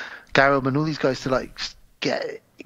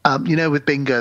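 usual speaking voice

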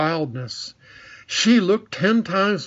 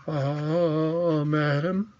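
put-on gasping old man; 3 dB quieter, much slower than usual. A narrator's reading. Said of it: "gasped Andrew".

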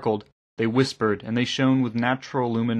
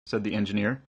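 plain reading voice

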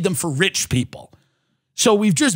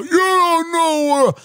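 mock angry critic; 9 semitones higher than usual. Critics yelling at him.

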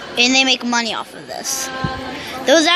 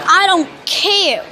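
defiant; 5 semitones higher, slower than usual. Real, not acted. Defiant retort.

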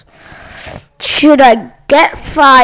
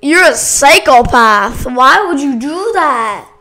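ordinary delivery

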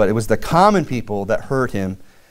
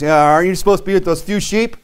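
mild mock anger; 6 semitones higher than usual. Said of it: "His brother gets all mad and says".

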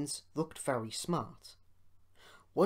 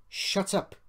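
sharp retort; 7 semitones higher, 6 dB louder than usual. A narrator's reading. Engine snaps at rude coach.

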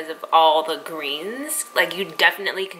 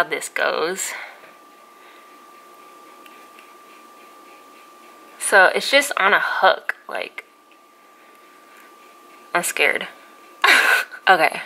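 cheek pulled aside by fingers; talking while unhooking rubber bands; fingers in mouth. Real, not acted. Seen 1: fingers hook cheek and reach inside mouth removing braces bands.

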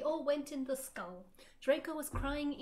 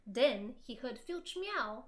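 ordinary delivery, nothing marked

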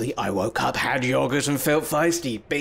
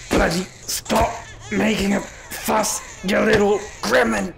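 slow, strained scolding, higher pitched; hammed-up fed-up outburst; exasperated scolding; 5 semitones higher than usual. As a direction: force out each word at the baby. Scolds a crying baby.

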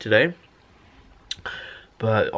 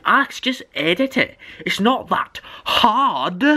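mock viewer voice; 8 semitones higher than usual. Imagining a viewer telling him to edit, mock smug voice. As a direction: mimic a smug viewer's advice. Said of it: "You're probably thinking".

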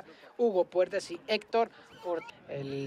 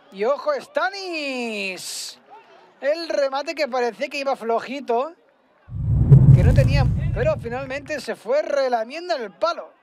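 excited commentary; 5 semitones higher, 6 dB louder than usual. Real, not acted. Shot just wide.

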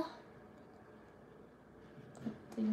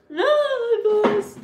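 loud, high, drawn-out mock wail; mock ghost scare; 8 semitones higher, 5 dB louder than usual. Streamer kidding viewers about a ghost, mock-scared wail. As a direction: wail in pretend fright.

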